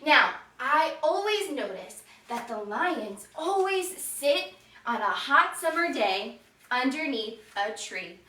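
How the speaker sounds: ordinary delivery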